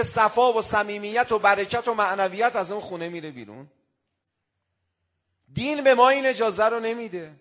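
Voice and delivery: loud, intoned warning; fervent preaching. Real, not acted. Preacher warning husbands and wives, loud and intoned. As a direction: chant it out as a warning to families. Preaching against shouting at home.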